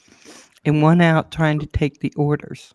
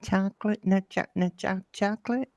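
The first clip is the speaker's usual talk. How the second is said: mimicking the customer; 3 semitones higher, 9 dB quieter than usual. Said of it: "I swear to, swear".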